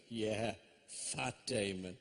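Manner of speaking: slow, low deadpan comeback; retold slow-burn putdown; would-be wit. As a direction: drag the retort out, low and dry. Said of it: "a brilliant wit that I am".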